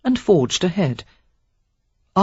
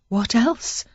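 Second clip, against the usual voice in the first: put-on worldly young woman; 7 semitones higher than usual. A narrator's reading. Sarah voiced in dialogue.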